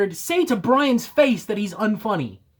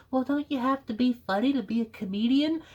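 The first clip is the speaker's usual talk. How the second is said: mock Joker's mom; 6 dB quieter, faster than usual. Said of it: "Joker's mom is like".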